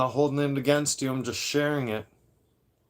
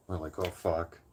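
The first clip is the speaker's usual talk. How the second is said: normal talking voice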